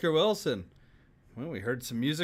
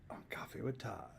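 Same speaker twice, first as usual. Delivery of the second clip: hushed sign-off whisper; 5 semitones lower, 13 dB quieter than usual. Real, not acted. Host closing the show for his viewers, whispering. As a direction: drop right down to a whisper for the show's name.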